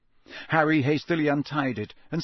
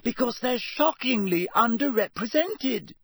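high-pitched speechifying, ignoring objections; insistent raised-pitch recital; loud; 9 semitones higher than usual. A narrator's reading. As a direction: plough on through your manifesto. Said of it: "speaking even more loudly than Ron".

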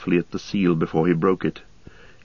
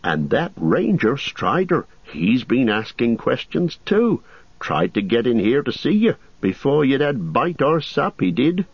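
higher, fussy innkeeper voice; innkeeper Butterbur; 6 semitones higher than usual. Acted. Landlord gossiping to Frodo about the ranger, raised fussy voice. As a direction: chatter like a nervous landlord.

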